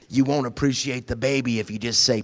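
usual speaking voice

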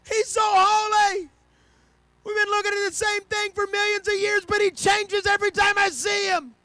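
high, loud, slow amazed shouting; awestruck angel shout. Acted. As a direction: gush at the top of your lungs. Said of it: "Another one's like".

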